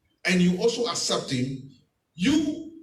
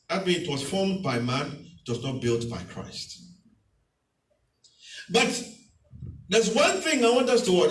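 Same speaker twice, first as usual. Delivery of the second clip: ordinary talk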